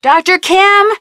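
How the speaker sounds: high, loud hail into the silence. Acted. Nancy calls Doctor Kim again with no answer, loud urgent hail.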